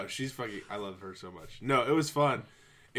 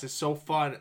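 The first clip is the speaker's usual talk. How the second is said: ordinary delivery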